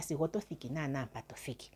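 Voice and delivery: hushed, secretive aside; whisper. Acted. Elder lecturing the community, suddenly whispering.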